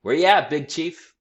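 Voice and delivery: high, loud holler; hollering back. A narrator's reading. Shorty hollers back to Big Chief, high and loud. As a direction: holler the greeting right back. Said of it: "Shorty hollered back".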